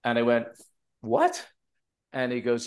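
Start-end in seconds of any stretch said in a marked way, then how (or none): 1.0-1.4 high, startled what; a jump of surprise on what; mock surprise. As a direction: squeak it out in disbelief. Retold surprise reaction.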